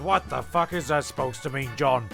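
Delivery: ordinary talk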